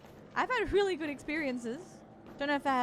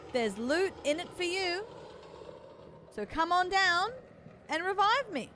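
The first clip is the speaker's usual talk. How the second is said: yelling across the room; 5 semitones higher than usual. Real, not acted. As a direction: shout it out to strangers nearby. Calling for someone to revive.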